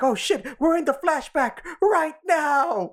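high, drawn-out dawning panic; mock alarmed realization. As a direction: imitate a slow, high-pitched freak-out.

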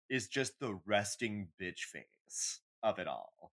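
normal talking voice

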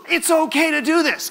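high, loud, bursting release; exploding with joy. Retold or imitated. Worshipper finally letting go at the song's end, loud joyful outburst. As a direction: let it burst out of you all at once. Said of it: "and I go, explodes".

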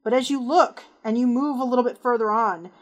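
ordinary talk

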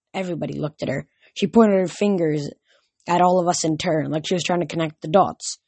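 plain reading voice

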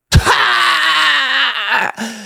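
high, shrill cackling laugh; mocking laugh. Laughing off the crying girl's call, mocking cackle. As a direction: cackle like you don't care at all. Mocking his careless younger self.

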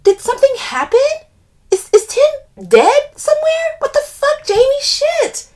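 very high, loud panicked questions; frightened. A narrator's reading. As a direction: blurt it out in mounting panic. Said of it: "pulling back from her frightened".

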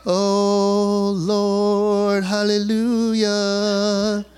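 low, slow, sanctimonious mock prayer; mock pious worshipper. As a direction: drawl it out like a fake holy man. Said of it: "you just get to be there and be like".

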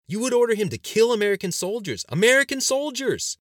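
very high, loud outburst of horror; hysterical, horrified shouting; bordering on hysteria. A narrator's reading. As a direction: cry it out aghast, drawing out the repeat. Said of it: "dread that bordered on hysteria".

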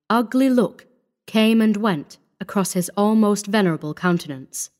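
ordinary delivery

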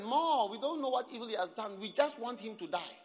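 high, loud crowd shouting; angry mob cry. Acted. Crowd demanding death, shouted high and loud. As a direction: yell it like a baying mob.

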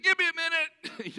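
breathless friend. Retold or imitated. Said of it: "He said".

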